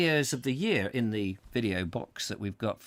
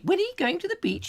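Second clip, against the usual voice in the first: put-on very high shocked old lady; gossiping old lady. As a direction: squeal it, high and prim. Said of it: "I've got to get out of character".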